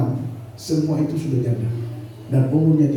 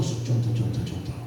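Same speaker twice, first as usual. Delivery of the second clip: hushed aside; 7 semitones lower, 7 dB quieter than usual. Shown as a demonstration. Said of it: "Contoh saja".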